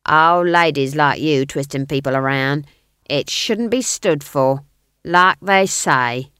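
loud, slow guard voice. A narrator's reading. Guard complaining about old ladies, loud slow role voice. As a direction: grumble loudly and slowly as the guard. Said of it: "the guard began".

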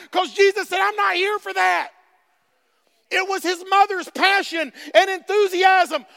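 loud, high preaching; fired-up sermon; full-force preaching. Acted. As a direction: preach it, building.

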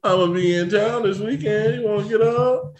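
high-pitched playful come-on; hammed-up flirty invitation. Acting out his flirty message.

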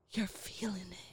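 hushed, whispered imitation of the girl; imitated whisper. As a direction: whisper it softly, as she did. Retelling girl's eerie line to the high narrator.

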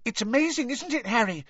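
very high, gushing squeak; eager little boy's piping voice; excited schoolboy. A narrator's reading. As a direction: gush to your hero. Excited Colin gushes to Harry.

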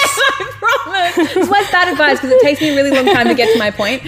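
talking through laughter, high-pitched; giggly, self-mocking admission; laughing. Real, not acted. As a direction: laugh your way through an apology.

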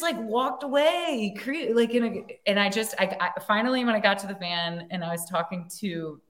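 usual speaking voice

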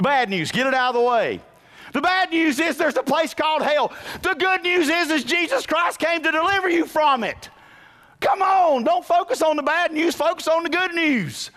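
high, loud sermon cry; soaring pulpit preaching; full-force preaching. Acted. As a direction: preach it out to the rafters.

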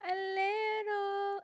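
high drawn-out teasing; mock his admission slowly; teasing echo. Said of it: "teasing echo of Seth".

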